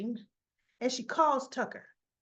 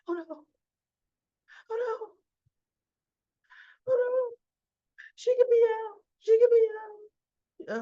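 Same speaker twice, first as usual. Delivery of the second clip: mock distress; 12 semitones higher, 5 dB louder than usual. Recapper acts out her reading the news, mock distress. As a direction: wail it in theatrical dismay.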